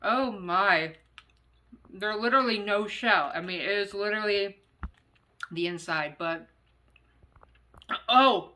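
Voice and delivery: talking while chewing candy; slightly muffled chewy speech; mouth full, chewing. Real, not acted. Seen 1: Candy put in mouth at -5; pressed, working lips throughout.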